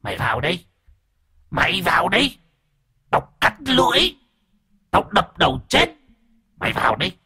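put-on high, eerie possessed child voice rising to a shout; eerie shriek. A narrator's reading. Possessed boy threatens the grandfather in a high eerie shriek. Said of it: "nó ré lên nói bằng cái giọng ghê rợn".

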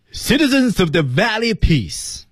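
high, slow proclamation of "Citizens of the Valley Peace"; 停顿示范. Shown as a demonstration. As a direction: call "Citizens of the Valley Peace" as one high phrase, then stop. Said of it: "我们注意停顿". (高而慢地宣告“Citizens of the Valley Peace”，随后停顿)

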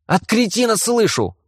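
shouting back. Acted. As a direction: yell the retort up high. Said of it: "прокричал в ответ Грейди".

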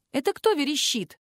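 high, curious question; curious asking. A narrator's reading. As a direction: ask with light interest. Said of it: "поинтересовалась у Кока".

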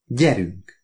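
lightly voiced tough-guy command; a restrained movie-hero order; Humphrey Bogart. A narrator's reading. Oliver orders his wife along, a hint of film tough guy. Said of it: "legszebb Bogart hangomon jelentettem ki".

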